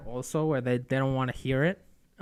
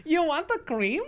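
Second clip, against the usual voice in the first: high, laughing Israeli accent impression; 14 semitones higher than usual. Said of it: "That was my Israeli impression".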